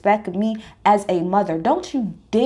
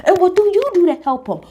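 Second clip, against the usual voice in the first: higher, louder challenge in a retold quote; retold confrontation; 8 semitones higher, 4 dB louder than usual. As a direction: demand an answer with a raised voice. Alexia confronting Todd, retold.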